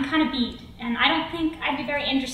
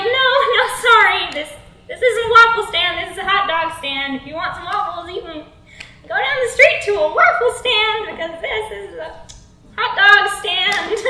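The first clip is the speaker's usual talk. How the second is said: higher, louder exasperated protest; flustered refusal; 6 semitones higher, 5 dB louder than usual. Acted. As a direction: turn him down, pitched up and insistent. Flustered self refusing the creepy customer.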